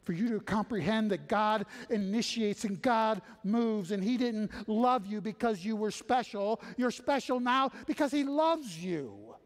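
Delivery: high, loud, driving sermon voice; full-force preaching. Acted. Preacher urging the congregation that God moves first, full force.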